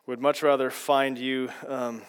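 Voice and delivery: low, hushed prayer voice, well below the teaching voice. Acted. Pastor praying over the class before the lesson, low and reverent.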